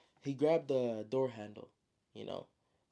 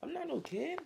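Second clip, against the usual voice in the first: put-on kid's squeaky retort. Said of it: "the kid's like".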